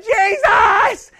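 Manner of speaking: shouted name, pitched high; loud cry. Retold or imitated. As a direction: cry out for Jesus. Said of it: "got loud with God".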